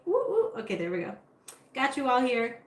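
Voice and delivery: ordinary talk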